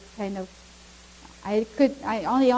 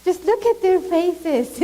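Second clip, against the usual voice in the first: begging; 6 semitones higher, 3 dB louder than usual. Retold or imitated. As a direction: plead on their behalf, voice lifted. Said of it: "just begging them".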